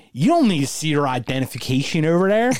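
put-on Obi-Wan quote in a light Philly accent. Host doing Obi-Wan in a Philly accent. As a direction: toss it off like a Philly guy. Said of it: "I'm gonna do it all in Philly voice".

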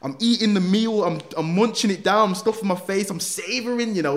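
can barely get it out for laughing; laughing hard. Real, not acted. Telling the listener about bawling over his last meal, laughing.

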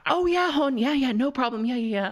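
high-pitched mock agreeing; Davina Divine. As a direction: imitate a flustered, eager-to-please queen. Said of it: "I could just hear Davina go".

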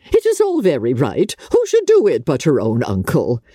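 high, gushing, fluttering mother; a silly mother beside herself with delight; giddy mother rattling off wedding plans; Mrs Bennet, overjoyed. A narrator's reading. Narrator voices Mrs Bennet.

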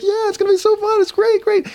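squealing, very high hyped-up voice; emotive and excited. Retold or imitated. Dad in his daughter's face to distract her, wildly excited. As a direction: gush at a child with exaggerated glee. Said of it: "emotive and excited".

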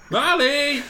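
high, loud call for someone missing. Acted. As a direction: holler the name into the distance. Calling out for missing Marley.

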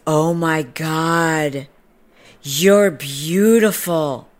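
slow, drawn-out gushing; mock awe. Stranger admiring the dog's owner, slow hammed-up awe. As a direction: imitate someone starstruck, stretching the words.

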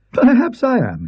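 high, airy scholar laughing it off; Parkins. Acted. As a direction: brush off the accusation lightly.

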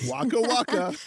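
high-pitched joke tag; a hint of cartoon comedian; lightly voiced comic catchphrase. Punchline catchphrase after own crude joke.

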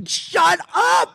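high, shouted retort at the crowd; mock shout. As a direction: snap back with a big fake yell. Retold shout at heckling tourists.

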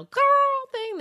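quick squeaky jump up, imitating a girl; mock girly voice. Host mocking the singer's put-on girl voice, squeaky. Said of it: "she did not have that whole".

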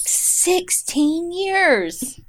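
slow, high stretched-out echo; drawn-out echo. Pretend. Echoing her friend, slow and high. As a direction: drag the words out up high.

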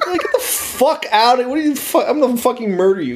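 high, tumbling pretend outrage; hammed-up alarm, laughter close by; mock outraged threat. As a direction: act out a panicked threat to an intruder. Imagined outburst at an intruder.

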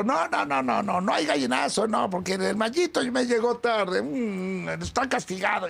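high, insistent imitation of a boss refusing; mock scolding. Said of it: "me estuvo regañando". (regaño imitado, agudo e insistente)

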